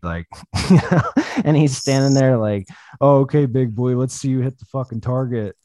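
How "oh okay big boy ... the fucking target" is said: higher, louder imitation of a trash-talking friend; mocking taunt. Friend daring him to hit the target, mocking taunt. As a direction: goad him loudly, in mock challenge.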